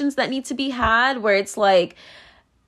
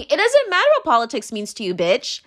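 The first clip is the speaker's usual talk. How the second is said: usual speaking voice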